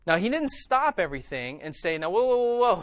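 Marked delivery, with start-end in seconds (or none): 2.0-2.8 higher, louder interjection as if stopping a crowd; mock urgent halt. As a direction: act out calling everyone to a stop. Acting out halting everyone at once.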